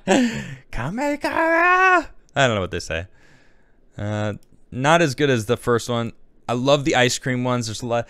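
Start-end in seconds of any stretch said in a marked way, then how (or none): 0.1-2.1 high, stretched-out battle cry; slow, drawn-out mock anime power shout; anime attack yell.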